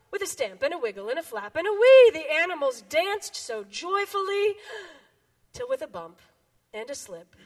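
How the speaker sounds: lively picture-book read-aloud; reading to children. Acted. Children's time pastor reading a picture book, bouncy. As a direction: read it to the kids with big animal voices.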